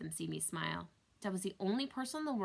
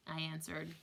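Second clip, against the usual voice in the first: ordinary delivery, nothing marked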